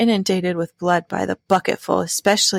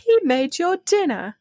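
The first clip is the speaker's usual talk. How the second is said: higher mock French waiter voice; hammed-up French chef; 10 semitones higher than usual. Presenting Ratatouille as a mock French chef. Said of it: "present, like, Ratatouille".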